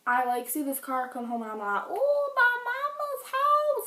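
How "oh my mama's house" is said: squeaky high-pitched exclaim, slowed; high squeal. Retold or imitated. Mimics own surprised exclamation.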